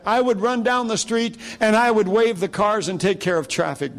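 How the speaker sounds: high-pitched, urgent pulpit exhortation; fervent preaching. Real, not acted. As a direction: drive the point home to the congregation, pitched well up.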